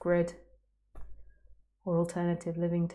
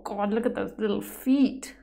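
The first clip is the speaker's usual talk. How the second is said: ordinary delivery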